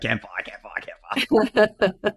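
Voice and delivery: mock eager chant, laughing through it; imitating Dirk. Host mimicking Dirk raving about campfires, laughing chant. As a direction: chant it like an overexcited colleague. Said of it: "All he is doing, like".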